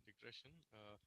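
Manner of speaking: faint, distant mic sound; barely audible, mic turned way down; very low mic level. Real, not acted. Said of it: "Sir, your voice is very low".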